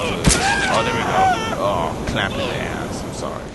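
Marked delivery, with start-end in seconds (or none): 0.0-1.6 very high, loud whoop of delight; squealing gamer cheer; excited shout. Real, not acted. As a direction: yell it out in glee. Player cheers the kill.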